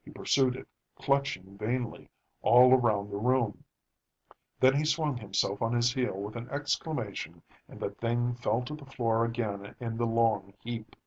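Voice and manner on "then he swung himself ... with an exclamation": plain reading voice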